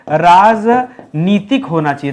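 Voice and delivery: राजनीतिक spelled out slowly, syllable by syllable; slow careful correction. Shown as a demonstration. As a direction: draw out राजनीतिक so each syllable lands. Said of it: "क्या होना चाहिए?". (धीरे-धीरे, साफ़ बोलिए: राजनीतिक)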